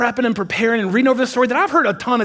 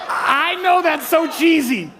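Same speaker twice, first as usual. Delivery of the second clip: laughing; 9 semitones higher, slower than usual. Real, not acted. Laughs at his own line.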